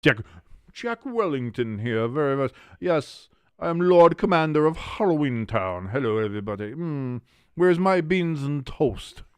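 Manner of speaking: a lord putting on bad British airs; bad British accent. Viewers hear a bad British accent as Jack Wellington. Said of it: "It's the worst British accent".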